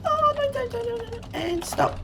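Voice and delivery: very high-pitched squeal of oh no; real squeaky fluster while braking; high-pitched panic. Real, not acted. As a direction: fret in a tiny high squeak while trying to stop. Trying to stop the car in game.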